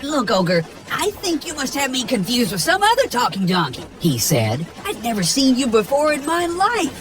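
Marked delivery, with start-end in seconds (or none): 0.0-3.9 high, fast chattering donkey. Acted. Donkey telling Shrek he's never met him, high and fast. 4.9-7.0 high, fast chattering donkey. Acted. Donkey telling Shrek he's never met him, high and fast.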